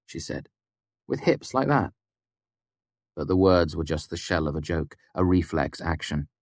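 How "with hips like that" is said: lightly voiced scornful young woman, higher; Devi, scornful. A narrator's reading. Devi brushing off Kvothe's threat, scornful. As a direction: sneer it from behind crossed arms.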